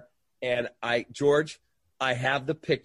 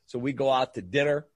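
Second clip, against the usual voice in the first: usual speaking voice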